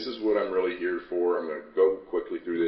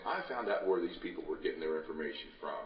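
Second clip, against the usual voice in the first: normal talking voice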